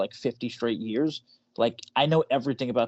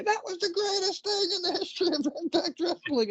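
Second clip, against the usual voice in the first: high excited announcer yell; screaming announcer. Retold or imitated. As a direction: hype it like a wrestling commentator. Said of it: "every time something happened in T N A".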